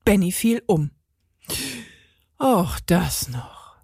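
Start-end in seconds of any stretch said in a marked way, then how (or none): 2.4-3.8 slow breathless huff; panting. A narrator's reading. Carlos huffs in dismay, out of breath. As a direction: puff it out, slow and unvoiced. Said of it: "schnaufte Carlos".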